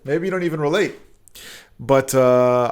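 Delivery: ordinary talk, nothing marked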